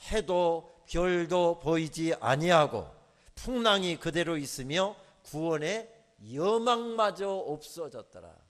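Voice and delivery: slow, loud solemn reading; a slow, loud Bible reading; scripture reading. Acted. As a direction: read the verse slowly and gravely. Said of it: "이렇게 묘사되어 있습니다".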